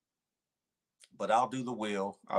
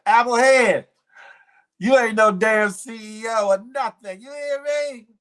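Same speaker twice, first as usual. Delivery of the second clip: high, loud mock scolding; hammed-up loud telling-off; playful scolding; 11 semitones higher, 10 dB louder than usual. Real, not acted. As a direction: call out the chatter high and loud.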